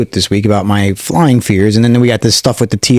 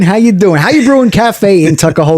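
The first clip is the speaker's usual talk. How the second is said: higher, upbeat radio ad voice; ad read; 7 semitones higher than usual. Acted. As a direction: sell the cafe like a radio spot. Ad read for a cafe.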